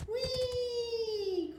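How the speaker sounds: very high delighted whoop; a child thrilled on a galloping horse; gleeful cry. A narrator's reading. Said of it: "cried Bob".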